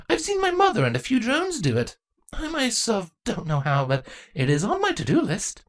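put-on light, friendly young princess voice; sheepish and apologetic; young changeling girl. Draco's voice.

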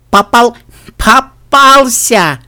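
high, loud, drawn-out gloating; taunting through laughter. A narrator's reading. Sister mocks her brother under the snow, gleeful. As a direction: crow it with stretched vowels. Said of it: "сквозь смех выдавила из себя Диди".

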